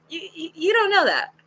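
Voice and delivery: high-pitched, stumbling mock objection; stammering protest. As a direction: act out a flustered comeback. Retold protest to date's doubt.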